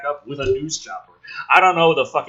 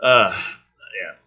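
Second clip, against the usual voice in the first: ordinary talk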